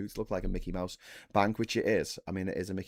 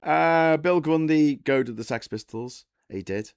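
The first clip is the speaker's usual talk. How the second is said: ordinary talk, nothing marked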